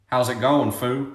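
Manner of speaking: put-on low, Tennessee country accent; a mild Southern country imitation; thick country accent. Country friend greeting narrator, low Tennessee accent. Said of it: "with his thick country accent".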